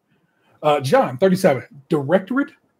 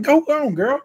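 sassy cheer; 11 semitones higher, slower than usual. Retold or imitated. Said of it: "it's like".

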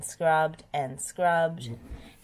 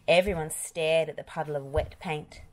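ordinary delivery

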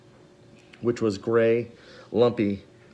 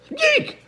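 very high squeal; baby shriek; 4 dB louder than usual. A narrator's reading. Sunny reacting to the case, high baby shriek. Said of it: "Sonny shrieked".

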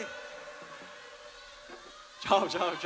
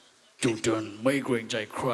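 lower, slowish imitation of [name]'s tone; 9 semitones lower, slower than usual. Bandmate imitating [name]'s voice on request. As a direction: mimic a singer's signature tone. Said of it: "ขอเสียงแบบพิกฤตโทน".

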